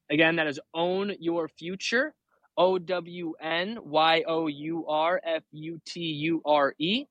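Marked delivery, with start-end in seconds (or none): 2.6-7.0 slow letter by letter; spelling out. Shown as a demonstration. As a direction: spell each letter slowly. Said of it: "There's your spelling lesson".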